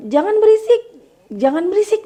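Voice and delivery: high loud scold of "jangan berisik"; shrill scolding. Shown as a demonstration. Parent shouting at noisy children, high angry scold. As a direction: snap at noisy kids, voice way up. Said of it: "kalau kita misalnya".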